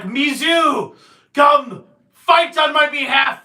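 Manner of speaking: shouted summons, slow and loud; commanding shout. Acted. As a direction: call the demon to fight. Villain shouts to his demon.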